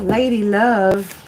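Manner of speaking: slow, stretched-out sultry Lady Love; a slow, playful croon; drawn-out Lady Love. Shown as a demonstration. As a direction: savour the name like a lounge singer. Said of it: "I don't know why I wanted to say Lady Love".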